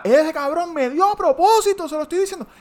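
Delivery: very high squealing accusation; hammed-up woman's protest; mock shrieking woman. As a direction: screech in the angry driver's voice. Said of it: "Y la tipa".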